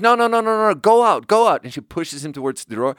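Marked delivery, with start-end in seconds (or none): 0.0-1.6 high, loud acted pleading; mock-panicked repeated command; urgent intervention. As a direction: frantically shoo him out, voice pitched up. Girlfriend breaking up a retold fight.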